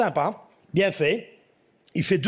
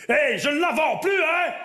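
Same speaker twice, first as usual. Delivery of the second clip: put-on old farmer's shout; 11 semitones higher than usual. Old farmer shouting he won't sell now. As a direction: yell it out, thrilled. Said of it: "il fait".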